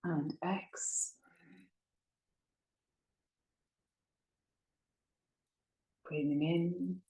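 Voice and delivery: soft, slow, drawn-out breath counts; guided breathing meditation. Acted. Yoga teacher counting listeners' breaths in and out, hushed meditative guiding. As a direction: pace the breath gently, holding each count long and level.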